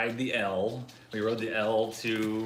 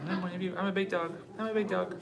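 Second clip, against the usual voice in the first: higher, lightly voiced puppy talk; voicing the dog; 9 semitones higher, faster than usual. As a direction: speak for the little dog proudly.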